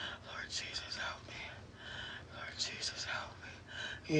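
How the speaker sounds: hushed, repeated whisper prayer; whispered plea. Real, not acted. Pleading with Jesus for help, whispered. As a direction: whisper it over and over.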